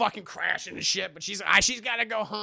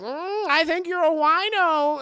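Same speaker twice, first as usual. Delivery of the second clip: higher, louder teasing accusation; mock accusing; 8 semitones higher, 6 dB louder than usual. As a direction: tease them, louder and higher. Mimics others teasing the speaker.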